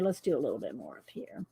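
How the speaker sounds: soft unvoiced whisper to herself. Real, not acted. Drawing alone, whispering to herself. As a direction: whisper it under your breath.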